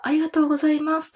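bright high campaign car thanks; campaign announcer voice. Retold or imitated. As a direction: chirp it like a campaign girl. Imitating uguisu-jo on campaign car. (選挙カーのウグイス嬢の声)

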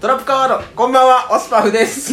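loud, high card-game call; hyped card announcer. Pretend. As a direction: announce it like a duel move. Yugioh card-game joke intro.

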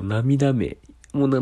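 ordinary talk, nothing marked

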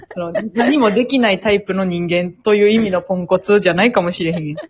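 normal talking voice

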